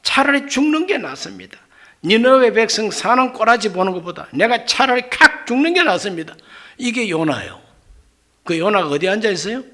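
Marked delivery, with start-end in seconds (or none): 0.0-1.6 higher, sulky outburst; petulant Jonah. Retold or imitated. Jonah telling God he would rather die, sulky. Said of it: "요나가 얼마나 성질이 못됐든지". 2.0-6.3 higher, louder huffy rant; indignant Jonah. Retold or imitated. Jonah fuming that Nineveh was spared, huffy. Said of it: "요나가 얼마나 성질이 못됐든지".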